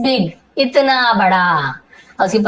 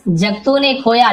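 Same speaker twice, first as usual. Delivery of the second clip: ordinary delivery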